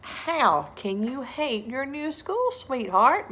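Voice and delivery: soothing dad. Acted. Father soothing Sarah about school, gently. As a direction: coax her kindly.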